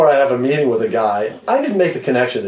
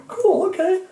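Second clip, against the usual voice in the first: very high, light mock casual; mock cool; slower than usual. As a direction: toss it off brightly. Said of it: "I'm going".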